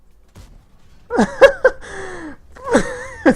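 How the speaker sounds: very high, loud shrieking laugh. Real, not acted.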